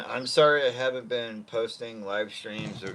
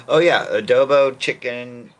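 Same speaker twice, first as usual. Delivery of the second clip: loud burst of remembering; oh yeah, got it; sudden recall; 2 semitones higher, 12 dB louder than usual. Real, not acted. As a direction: blurt it out as it comes back.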